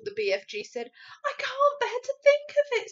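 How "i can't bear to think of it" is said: put-on high, loud child-character outcry; distressed cry. A narrator's reading. Sophie horrified for the children, high anguished cry. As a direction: cry out in dismay, pitched right up. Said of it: "Sophie cried".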